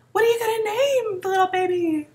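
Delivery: high, cooing mock-nosy question; exaggerated sing-song prying; gushing curiosity. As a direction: imitate someone gushing over a baby. Mimics nosy baby-name question.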